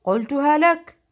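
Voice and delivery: loud told-you-so from inside a bottle; big, ringing genie voice; hollow genie voice. A narrator's reading. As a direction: call it out triumphantly. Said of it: "قال بصوت أجوف".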